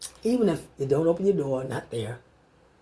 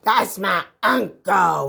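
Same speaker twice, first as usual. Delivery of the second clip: slow, drawn-out put-on voice, lightly voiced; mock bragging quote; 4 dB louder, much slower than usual. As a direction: drag it out like a show-off. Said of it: "and say".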